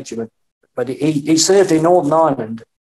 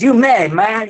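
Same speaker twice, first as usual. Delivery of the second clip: lightly imitating a rock star saying you may; 6 semitones higher than usual. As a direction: toss it off like a cool rock star. Quoting [name]'s drawled reply.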